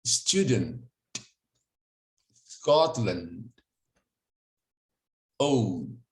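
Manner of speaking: "Student" ending on the t, no extra i; no added vowel. Shown as a demonstration. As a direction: stop cleanly on the final consonant. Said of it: "colocar um I no final". ("Student" sem I no final)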